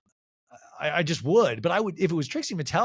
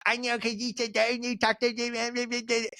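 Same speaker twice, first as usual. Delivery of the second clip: put-on babyish, slow, higher little-girl voice; mock baby voice; 7 semitones higher, slower than usual. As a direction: mimic a whiny baby talker, slowly. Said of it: "imitating Whitney's baby voice".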